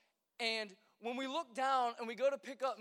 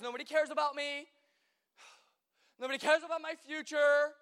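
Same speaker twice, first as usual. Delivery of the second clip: sulking self-pity; 3 semitones higher, 5 dB louder than usual. Pretend. Preacher acting out a lonely sulker, loud whiny pout. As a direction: play the sulker hiding in his cave. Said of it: "I can just be like".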